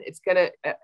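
ordinary talk, nothing marked

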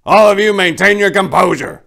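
stern loud order. Acted. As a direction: lay down the law to the crowd. Santa orders the unruly crowd.

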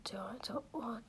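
soft unvoiced mutter to herself; whispered aside. Real, not acted. Gamer mutters confused to herself, whispering. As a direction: whisper it under your breath.

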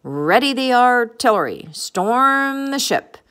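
high, loud pirate battle orders; pirate captain's rallying shout; roared orders. A narrator's reading. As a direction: draw out the command to attack. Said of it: "roared the pirate captain".

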